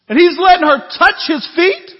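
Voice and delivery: building from firm to a high, loud outraged "She's a sinner"; indignant outburst. Shown as a demonstration. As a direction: let the boil rise into a shout. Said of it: "You can almost hear the internal dialogue of this Pharisee".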